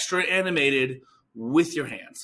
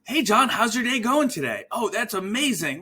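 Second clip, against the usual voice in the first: much higher, bright sales pitch; annoyingly positive telemarketer; 11 semitones higher, 3 dB louder than usual. Shown as a demonstration. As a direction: oversell every word with fake cheer. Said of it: "annoyingly positive telemarketer voice".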